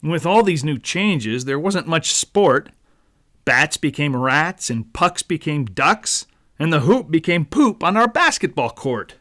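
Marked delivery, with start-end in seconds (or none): none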